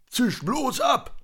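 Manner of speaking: very high, drawn-out dismissal; put-on cantankerous rebuff; gruff snap. A narrator's reading. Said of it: "blaffte er die beiden an".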